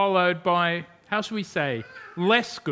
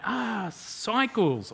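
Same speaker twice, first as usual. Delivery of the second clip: slow, higher "ah" as the penny drops; dawning realization; 5 semitones higher, much slower than usual. Retold or imitated. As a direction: draw it out, finally understanding. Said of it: "And we're like".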